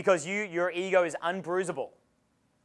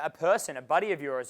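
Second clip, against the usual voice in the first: ordinary talk, nothing marked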